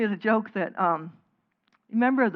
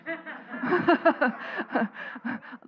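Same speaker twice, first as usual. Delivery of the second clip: laugh; 7 semitones higher than usual. Real, not acted. Teacher still chuckling at the dog joke, real laugh. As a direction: let the laugh roll on.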